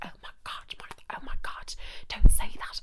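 scandalised whispering; imitating horrified whisperers; hushed shock. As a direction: whisper a warning to a friend. Other girls' shocked hushed reaction.

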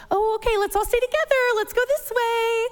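high, hurried, fussy sing-song; fussy helicopter mom. Retold or imitated. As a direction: herd everyone together anxiously. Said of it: "I am the type of parent, I get in the way".

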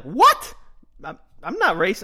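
loud high indignant what; bristle, shocked at the charge; offended mom. Retold or imitated.

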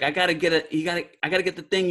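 fast, scattered New York street voice; New York street talk. Retold or imitated. Abel fumbling for the word dustpan, in fast New York talk. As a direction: rush through it like a spaced-out street guy. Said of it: "he kinda talks like, like bebop".

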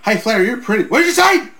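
hammed-up starstruck woman; flirty valet. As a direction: flirt with the wrestler, then act shocked. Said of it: "She was like".

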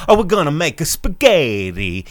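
loud, high cartoon Italian accent; hammed-up Italian, sing-song and exclaiming; mock Italian accent. Host parodying the movie trailer's family, mock Italian accent. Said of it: "talk about it the way they talk about it".

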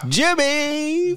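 squeaky high falsetto on the name. Acted. Mock falsetto singing name.

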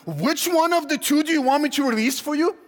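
high-pitched, disbelieving questions; incredulous. Acted. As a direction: question them as if you can't believe your ears. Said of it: "like, he can't believe it".

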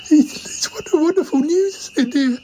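high, faltering joy; overcome, voice pitched right up; emotional delight. Acted. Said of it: "We're all a bit emotional now".

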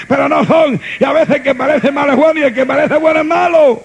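loud, driving sermon voice; full-force preaching. Acted. Pastor preaching hard to his congregation, full-force preaching. Pastor urging the church to worship.

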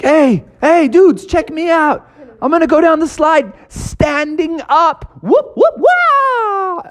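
high excited show-off voice; cocky frog showing off. Acted. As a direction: brag to the dudes, way up high. Froggy shows off on slide.

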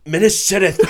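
high, loud comic northern; Boromir's northern accent. Acted. Boromir rallying for Minas Tirith, hammed-up northern accent. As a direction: belt it out in mock northern. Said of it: "Where's your northern accent?".

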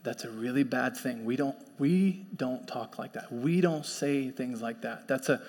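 low, soft, measured fatherly voice; dad gently correcting. Retold or imitated. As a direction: correct a child quietly without scolding. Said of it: "He's like".

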